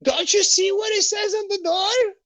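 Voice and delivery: high, loud mock yell from behind the door; imitated scream. The angry lady yells through the door at the salesman, in a high mock scream. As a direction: shriek it like an angry homeowner. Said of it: "she screams from inside".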